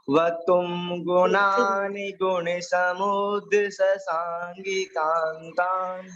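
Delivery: slow, measured chanting of a Sanskrit shloka; teacher intoning a shloka line by line; verse recitation. Shown as a demonstration. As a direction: recite the verse slowly for the class to repeat.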